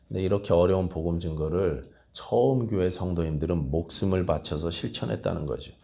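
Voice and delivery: usual speaking voice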